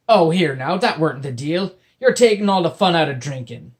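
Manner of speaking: loud indignant pirate; protesting pirate. Acted. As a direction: complain loudly like a cheated pirate.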